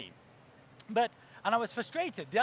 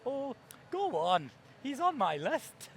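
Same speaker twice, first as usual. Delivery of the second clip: slow, higher-pitched coaxing mom; pleading mother; 4 semitones higher, slower than usual. Retold or imitated. Mom talks son round about Cliff, cajoling.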